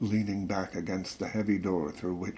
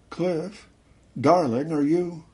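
high, louder questioning call; woman calling; 8 semitones higher, 7 dB louder than usual. A narrator's reading. Wife enters the room looking for Cliff, calling out. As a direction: call out searchingly, pitch well up.

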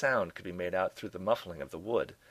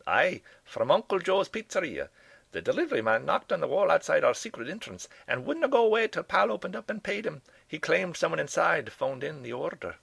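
Scottish professor; 10 semitones higher, 7 dB louder than usual. Acted. Old Scots professor tells the detective about the pizza, in a high, loud Scottish brogue. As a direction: boom it out like an excitable old Scots academic. MacGuffin's lines, story dialogue.

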